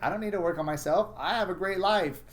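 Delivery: a put-on, self-satisfied voice for people who won't change; mocking people in denial. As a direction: parody their breezy self-assurance. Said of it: "And then they'll be like".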